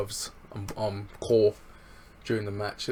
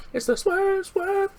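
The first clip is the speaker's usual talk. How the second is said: hype call; 7 dB louder, slower than usual. Retold or imitated. Hyping Swerve's name.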